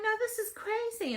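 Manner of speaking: high-pitched disbelieving refusal; incredulous protest. Acted. Phyllis refuses in acted dialogue.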